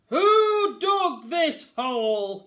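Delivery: very loud, slow angry bellow; bellowing captain. A narrator's reading. Captain fallen in a hole, angry bellow. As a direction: roar it from the bottom of the hole. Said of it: "he bellowed".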